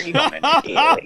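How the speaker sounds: laughing. Real, not acted. Laughing retort.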